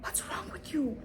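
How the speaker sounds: high, hushed whisper of disbelief; incredulous whisper. Retold or imitated. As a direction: whisper it as if he's lost his mind. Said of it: "I was like".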